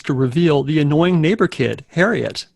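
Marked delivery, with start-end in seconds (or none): none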